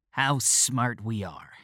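slow, mocking sarcasm; slow sneering irony. A narrator's reading. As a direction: drawl out the mockery. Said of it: "suggestion of a sneer".

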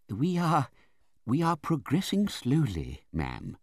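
high, slow, hesitant doctor, falling at the end; Mister Chillip. A narrator's reading. Doctor gives the aunt slow news, meek. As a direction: report haltingly and deferentially.

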